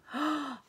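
short, soft gasp of alarm; dramatic gasp. A narrator's reading. Storyteller reacts to the charging troll, dramatic gasp.

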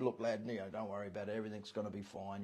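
Jesus calming a nervous lad, soft and kind; kind reassurance. Retold or imitated. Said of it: "would have put the lad at ease and said".

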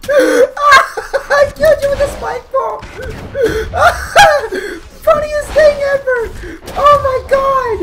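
squealing high through real laughter; high-pitched, cracking up mid-sentence; hysterical laughter. Real, not acted. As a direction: barely get it out, laughing loudly. Laughing hysterically at a game kill.

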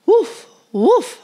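high, loud yappy woofs; barking dog. A narrator's reading. Little dog barking at the school bus. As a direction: bark it like an eager little dog. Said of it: "barked Titus".

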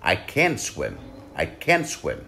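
"can" hit firmly each time; stress on can. Shown as a demonstration. Teacher drilling stressed "can" for students. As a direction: punch "can" in "I can swim". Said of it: "nhấn mạnh vào chữ can".

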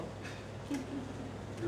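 breathy airy laugh trailing off; soft laugh. Real, not acted. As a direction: laugh at her own wildness. Laughs after quoting herself.

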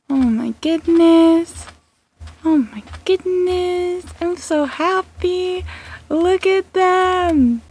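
slow, high cooing to pets. Real, not acted. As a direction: melt over cute cats. Cooing at cats.

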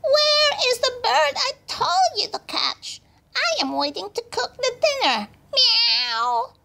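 shrieking cat, very high; Missus Ginger. A narrator's reading. Mother cat demanding her dinner bird, very high meowing voice. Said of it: "Missus Ginger came by asking".

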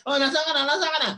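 high, loud, worried call into the phone; anxious caller. Acted. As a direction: sound like a suspicious partner chasing them down. Anxious partner calling on phone.

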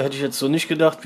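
ordinary talk, nothing marked

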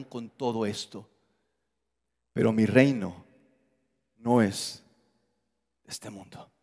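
Jesus answering his accusers with serene calm. Retold or imitated. Jesus replies with peace.

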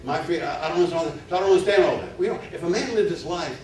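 high, loud, driving sermon delivery; fervent preaching. Acted. Preacher pressing the congregation about faith, fervent. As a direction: preach it hard, rising to a peak. Preaching at full force again.

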